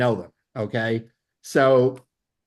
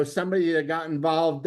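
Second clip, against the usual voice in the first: usual speaking voice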